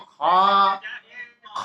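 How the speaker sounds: "খ" as a long rasped Arabic kha; back-of-throat kha. Shown as a demonstration. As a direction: scrape "খ" from the end of the throat and hold it. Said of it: "কণ্ঠনালীর শেষ থেকে উচ্চারণ হবে". (কণ্ঠনালীর শেষ থেকে ঘষে টেনে "খ")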